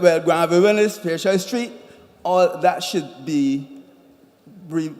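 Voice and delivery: ordinary delivery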